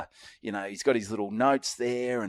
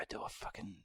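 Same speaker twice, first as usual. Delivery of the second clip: whispering; 21 dB quieter than usual. Retold or imitated. Nervous inner thought at door.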